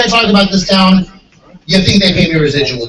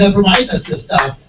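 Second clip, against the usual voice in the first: ordinary talk, nothing marked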